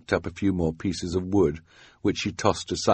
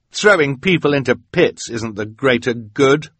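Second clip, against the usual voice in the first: raised, loud protest; indignant cry; 6 semitones higher, 6 dB louder than usual. A narrator's reading. Quigley rebuking Esme about the pit, indignant cry. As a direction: object hotly in a raised voice. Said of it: "Quigley cried".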